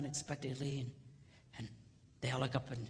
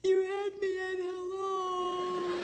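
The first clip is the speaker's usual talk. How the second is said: much higher, very slow, dreamy; swooning heroine; 5 dB louder, much slower than usual. Acted. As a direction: melt as you say it.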